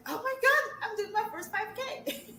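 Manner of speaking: high, loud squeal of joy with a laugh; thrilled runner. Retold or imitated. Client bragging about her first five K, squealing. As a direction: squeal it like you just signed up for a race. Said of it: "You're like".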